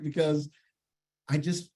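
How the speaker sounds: ordinary talk, nothing marked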